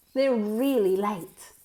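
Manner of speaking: ordinary delivery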